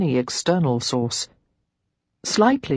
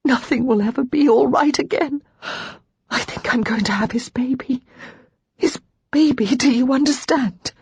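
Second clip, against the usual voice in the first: despairing; 8 semitones higher than usual. Acted. Lara sobbing in despair.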